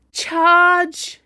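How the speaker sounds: high, loud rallying cry; battle yell. A narrator's reading. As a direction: yell it out to launch the attack. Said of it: "she yelled".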